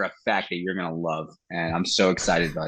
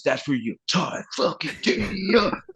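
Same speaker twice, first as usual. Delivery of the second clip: higher, gleeful retold pitch of the joke; imitating his bandmate; 12 semitones higher than usual. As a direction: deliver it like a proud punchline. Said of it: "He goes".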